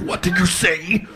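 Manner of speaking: mock-menacing rising question; imitated fuming boss. Boss breathing fire at the employee, mock menace. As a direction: play a boss about to erupt.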